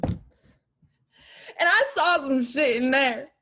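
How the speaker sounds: slow, loud and weighty; slow ominous emphasis. Acted. Daughter hints at what she saw in the mansion, slow dread. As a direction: draw it out with dread.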